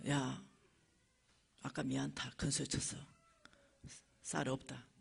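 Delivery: soft, low, sheepish confession with pauses; humble apology. Retold or imitated. Preacher re-enacts apologizing to the friend, humble and subdued. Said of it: "그냥 겸손하게".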